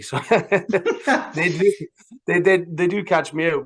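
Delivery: laughing through the words, pitch up; amused laugh mid-phrase. Real, not acted. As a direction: chuckle out the admission. Admits being caught out.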